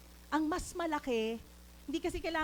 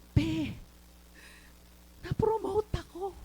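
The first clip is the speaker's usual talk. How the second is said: higher, louder voice imitating someone shaken and tearful; trembling voice; 6 semitones higher, 3 dB louder than usual. Friend phoning with promotion news, trembling voice. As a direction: re-enact a friend shakily breaking the news. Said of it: "nanginginig boses niya".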